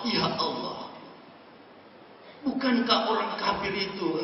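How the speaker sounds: pleading prayer. Acted. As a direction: plead quietly like a servant praying. Acts out a humble prayer. (doa memohon yang lirih dan rendah hati)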